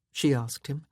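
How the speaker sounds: ordinary delivery, nothing marked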